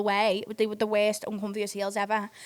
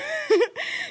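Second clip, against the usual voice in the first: high giggle. Real, not acted. Laughing at story.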